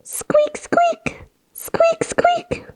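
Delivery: very high whispered squeaks; tiny breathy high squeaks; mouse squeaks. Shown as a demonstration. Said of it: "Deep breath in".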